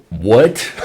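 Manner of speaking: normal talking voice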